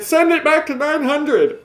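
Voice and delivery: high, slow, gloating mock trader voice; mocking an excited bear. Vlogger mocks crypto bears cheering a crash, high gloating voice. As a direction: parody the cheering short sellers. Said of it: "all the bears are out here so excited".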